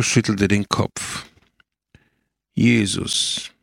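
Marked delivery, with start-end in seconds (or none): 2.5-3.5 understated, serious address; earnest. A narrator's reading. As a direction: say it gravely, without raising the voice. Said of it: "sagte er ernst".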